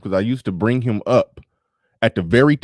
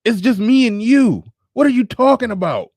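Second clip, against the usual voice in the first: mock exasperated host; host pleading with guest, high disbelief; incredulous self; 11 semitones higher than usual.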